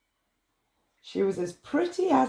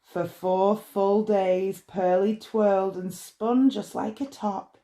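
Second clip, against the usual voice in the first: ordinary delivery, nothing marked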